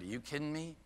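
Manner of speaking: quiet, dry retort; understated imitation of an incredulous director; retold eye-rolling disbelief. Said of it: "kind of rolled her eyes and she said".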